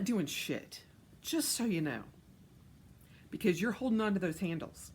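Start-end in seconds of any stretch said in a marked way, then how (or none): none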